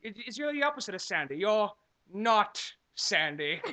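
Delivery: put-on lightly British-accented lovesick Anakin; hint of a British accent on a cheesy love line; mock British Anakin. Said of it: "For some reason, I had a British accent".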